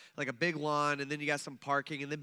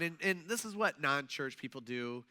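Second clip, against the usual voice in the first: usual speaking voice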